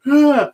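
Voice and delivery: a high, loud cry of terror; retold scream. Retelling the dream, he screams high and loud in fright. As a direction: scream as if waking from a nightmare. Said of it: "and then I start screaming".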